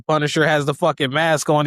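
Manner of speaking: ordinary talk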